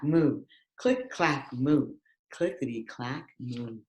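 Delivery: plain reading voice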